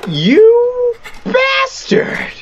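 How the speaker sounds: drawn-out high shout of betrayal; real startled shout at the game; shocked outcry. Real, not acted. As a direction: yell it long and high, caught out. Tricked by prank call in game.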